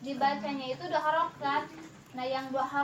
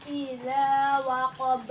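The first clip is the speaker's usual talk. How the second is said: Quran recitation demo; 4 dB louder, slower than usual. Shown as a demonstration. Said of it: "Terus disebutnya juga mad".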